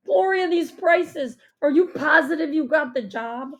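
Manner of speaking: loud, high, alarmed outburst; panicked. A narrator's reading. Dad seeing the menu prices, panicked. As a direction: exclaim in mounting panic. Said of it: "his hands begin to tremble".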